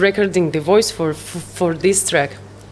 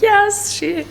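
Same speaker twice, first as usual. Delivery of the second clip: retold joy, much higher, squealing yes; overjoyed yes; 13 semitones higher than usual. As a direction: replay the thrill of her saying yes. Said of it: "I could not believe my happiness".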